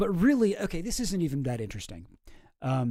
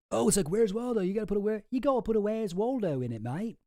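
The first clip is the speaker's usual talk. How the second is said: put-on a light, slightly higher Australian accent; 6 semitones higher than usual. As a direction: imitate an Aussie mate, mild Australian accent. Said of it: "I showed it to my Australian buddy".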